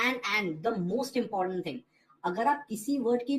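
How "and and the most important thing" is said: ordinary talk, nothing marked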